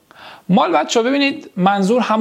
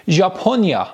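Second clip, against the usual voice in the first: ordinary talk, nothing marked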